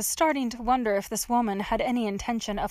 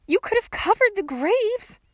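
put-on high, louder young girl's reproach; arguing; 11 semitones higher, 5 dB louder than usual. A narrator's reading. Trapped girl blames the gravedigger, high and indignant. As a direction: argue back indignantly.

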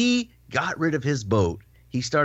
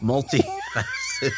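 laughing; slower than usual. Real, not acted. Joking word choice with laugh.

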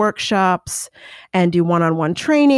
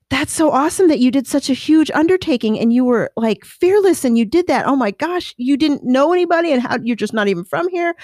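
admiring fan; 7 semitones higher than usual. Retold or imitated. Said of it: "women would come up to me and say".

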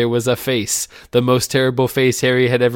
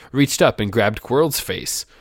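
ordinary delivery

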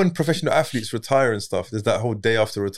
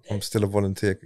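deadpan; 5 semitones lower, 5 dB quieter than usual. Retold or imitated. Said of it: "that just sounds a bit dead".

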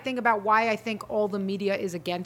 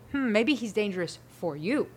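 suspicious musing; 2 semitones lower, 6 dB quieter than usual. Retold or imitated. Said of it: "That's when I say".

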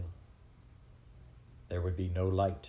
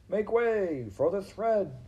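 high, drawn-out crier's call; mouse crying out; 12 semitones higher, 4 dB louder, slower than usual. A narrator's reading. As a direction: stretch the call high over the crowd. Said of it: "cried a mouse".

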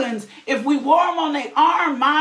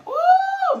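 sudden high preacher's whoop; high ecstatic whoop. Real, not acted. Preacher whooping to the congregation, high joyful cry. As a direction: cry out in joy.

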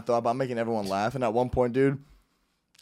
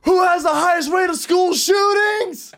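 high, slow shouted question; retold shout; 4 dB louder, slower than usual. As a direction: bellow the question at the crowd. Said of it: "and I go".

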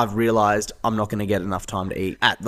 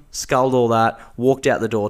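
usual speaking voice

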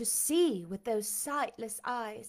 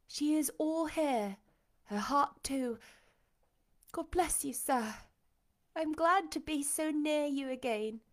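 higher, tender gladness; glad young woman; 5 semitones higher than usual. A narrator's reading. Jane reunited with her blind master, tenderly glad. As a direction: lift the voice with affection. Jane joyfully reveals herself.

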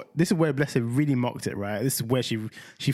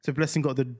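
ordinary talk, nothing marked

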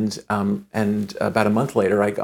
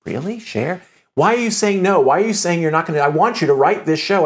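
higher, louder disbelieving questions; incredulous reply; 8 semitones higher, 4 dB louder than usual. Retold or imitated. Asking Cher why, higher with disbelief. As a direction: push back in amazed disbelief.

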